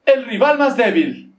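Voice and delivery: loud, slow game show title; game show announcer. Pretend. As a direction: boom it out like a TV host.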